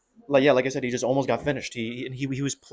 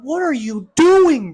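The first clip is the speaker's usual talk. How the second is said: louder, higher, slow what are you doing; exasperated yell; 10 semitones higher, 8 dB louder, slower than usual. Real, not acted. Fan yelling at a fighter who stopped attacking, exasperated. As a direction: drag it out in disbelief.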